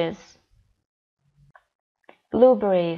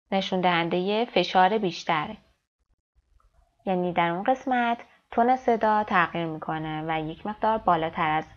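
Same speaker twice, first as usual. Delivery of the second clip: ordinary talk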